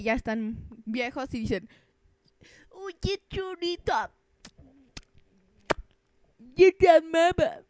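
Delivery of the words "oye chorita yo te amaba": put-on old man voice. Old man confessing love, voiced.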